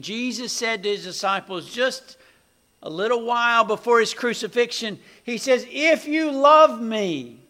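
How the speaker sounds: building to a loud, high-pitched proclamation; forceful preaching. Real, not acted. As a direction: preach it out, rising to full force.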